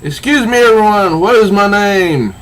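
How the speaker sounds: high, loud grandstanding call to the crowd; mock villain address. Maximus calling out to the club crowd, hammed-up. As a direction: announce yourself to the room like a showman. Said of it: "'Cause he says".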